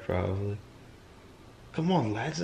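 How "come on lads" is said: attempted British lad talk; British accent. Shown as a demonstration. Trying accents for a friend before giving up, British. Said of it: "I could do British more than Irish".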